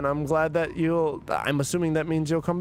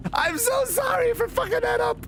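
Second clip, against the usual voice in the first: laughing apology. Real, not acted. Streamer laughing apologizes to chat for the bad accent, shouting. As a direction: squeal the apology while cracking up.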